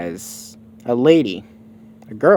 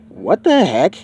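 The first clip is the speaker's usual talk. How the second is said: a lightly voiced confused hero, a bit louder; puzzled what-the-heck, pitched up a touch; dazed Goku voice; 5 semitones higher, 5 dB louder, slower than usual. Goku waking up after the car, lightly voiced and puzzled.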